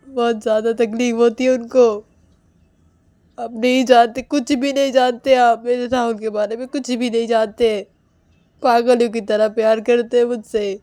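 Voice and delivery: loud, fierce warning to the family; an enraged wife warning everyone; furious threat. Acted. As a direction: threaten them, nearly shouting. Woman threatens family over Rahul.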